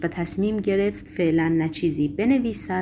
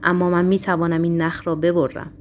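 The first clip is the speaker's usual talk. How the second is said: ordinary delivery, nothing marked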